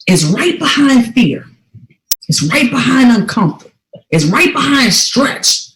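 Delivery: slow, weighty sermon delivery; slow preaching emphasis. Acted. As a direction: let each phrase land. Preaching lesson slowly.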